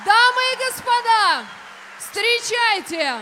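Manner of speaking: громко и торжественно, растягивая; протяни "встречайте" на весь зал; ringmaster. Real, not acted. Конферансье объявляет звезду вечера. (громкий голос конферансье)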